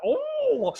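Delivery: very high mocking ooh; hammed-up high ooh sound. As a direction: squeal the ooh up high as a send-up. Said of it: "real kind of hack trope".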